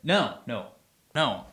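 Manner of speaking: ordinary delivery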